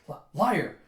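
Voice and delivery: loud, stammered accusation; accusing. Retold or imitated. Guard calling out Yun's bluff, accusing.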